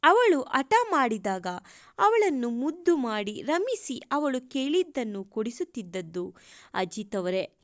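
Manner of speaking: ordinary delivery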